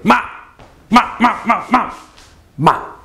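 MA yelled sharply, falling from high; fourth tone. Shown as a demonstration. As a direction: fire out short, sharp falling MA. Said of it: "just yell out a word sharply".

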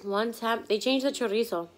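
chewing while talking; words muffled by food; mouth full. Real, not acted. Seen 1: Taco in hand, cheeks slightly full, lips working as she talks.

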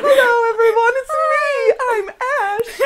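very high, breathy princess voice; squeaky storybook princess. Retold or imitated. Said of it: "my princess voice".

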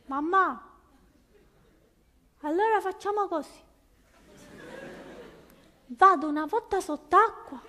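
put-on child bargaining with mother from the water; whiny child. Kid bargaining.

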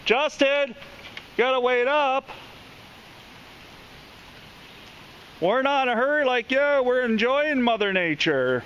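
high calls, fast at first; calling out. Real, not acted. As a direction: owner calling the dog ahead to wait up. Calling after the dog.

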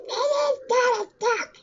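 high cartoon duck voice; Donald Duck. Gamer showing off his impression, high cartoon duck voice. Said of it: "that was my Donald Duck impression".

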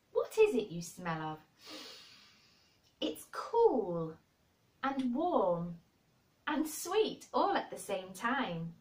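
slow, savoured wonder, words set apart; joyous. A narrator's reading. Boy marvelling at what his friend smells of, joyous. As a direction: relish each smell with delight. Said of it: "he cried out quite joyously".

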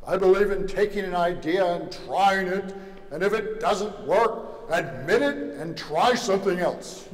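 orate like an upper-class New Yorker president; patrician Roosevelt accent. Retold or imitated. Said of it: "Not exactly in that accent".

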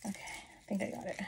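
soft unvoiced whisper. Real, not acted. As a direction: whisper it to yourself. Fiddling with harness, whispering.

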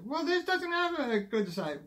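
high, whiny skeptic voice; high mock complaint. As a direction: whine it like a doubter. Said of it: "imagined objector".

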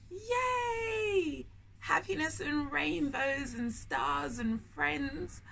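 a very high squealing yay, then slow delighted listing; cheering yay. Acted. Storyteller celebrating with young listeners, squealing cheer. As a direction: cheer like a child at a party.

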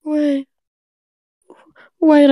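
ordinary delivery

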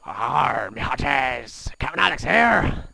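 loud slow pirate captain. Acted. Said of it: "put the pirate voice back on".

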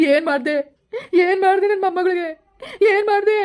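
high, tearful demand; distraught grandparent confronting the culprit, voice pitched high; anguished outrage. Acted. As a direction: cry out in horrified accusation. Confronts man over granddaughter's harm.

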